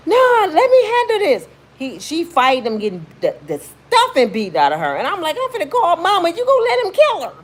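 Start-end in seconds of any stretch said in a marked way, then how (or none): 0.1-1.5 high, loud stubborn refusal; stubborn high shout; defiant niece. Retold or imitated. Niece refusing help, louder. 5.4-7.3 high, loud frantic and half laughing; hurried desperate plea; panicked threat. Retold or imitated. Panicked kid threatening to call mom.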